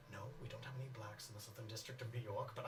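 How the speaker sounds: hushed and low, still audible; audible whisper. Acted. Hazel crossing the room to Ronnie, audible whisper. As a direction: lean in and say it under your breath. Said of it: "in an audible whisper".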